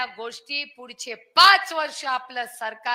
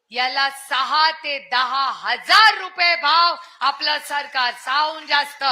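rally promise; 3 semitones higher, 7 dB louder than usual. Real, not acted. Campaign promise at full rally force.

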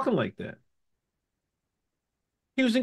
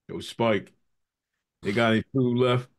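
low, casual everyday voice; 6 semitones lower than usual. Retold or imitated. Retelling singer asking a director for food, deep and casual. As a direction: drop deep for the singer's off-stage voice. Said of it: "his voice was deep as shit".

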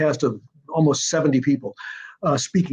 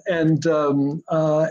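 ordinary talk, nothing marked

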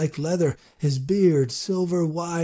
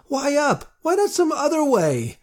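much higher, rushed questions; quick and anxious; 12 semitones higher, 4 dB louder than usual. A narrator's reading. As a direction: fire the questions out fast. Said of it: "speaking quickly".

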